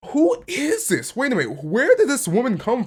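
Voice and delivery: a squeak of total confusion; startled. Real, not acted. Shocked by a sudden transformation.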